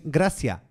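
imitated learner's "gracias", final s not aspirated; foreigner's careful "gracias"; unaspirated final S. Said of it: "no aspiran la S final".

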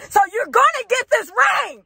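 high, hammed-up insisting cry; mock insistent outburst. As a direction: raise it high, playfully demanding. Acting out insistent proposer.

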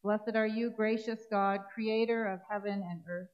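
ordinary talk

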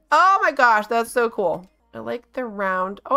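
normal talking voice

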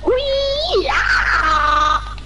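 high gleeful squeal into a yell; excited whee. Real, not acted. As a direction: squeal with glee then shout. Falling from height in game.

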